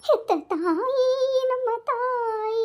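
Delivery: very slow, drawn-out words; very slow, weighty delivery; dramatic slowdown. Acted. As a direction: stretch each word for melodrama.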